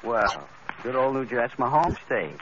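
ordinary talk, nothing marked

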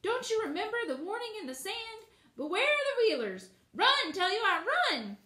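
high, loud squawking hen voice; frantic hen. A narrator's reading. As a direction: urge her to flee, high and shrill. Hen urges girl to flee.

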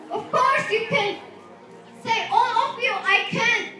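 loud, emphatic motivational rallying; rallying the audience. Real, not acted. Speaker getting everyone to say they can, loud rallying cry. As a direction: urge the crowd on with punched key words.

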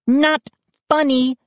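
high-pitched, offended reply; annoyed retort. A narrator's reading. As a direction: say it with a glare. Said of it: "glared at us".

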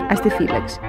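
ordinary talk, nothing marked

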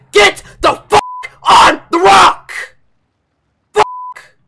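high, very loud rage yell; furious shout. Real, not acted. Gamer at the rock he keeps missing, furious shout. Gamer raging at a failed climb.

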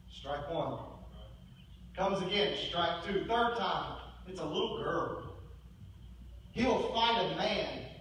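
usual speaking voice

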